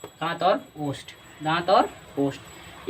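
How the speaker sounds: slow, drawn-out दांत और ओष्ठ; slow answer. Shown as a demonstration. Teacher answering his own question for the class, slowly. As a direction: lay out दांत और ओष्ठ word by word. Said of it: "वो का उच्चारण स्थान क्या होता है?".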